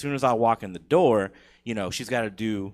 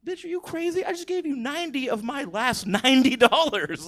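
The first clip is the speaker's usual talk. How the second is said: mock outrage; 4 dB louder than usual. Comic's inner outrage at the price.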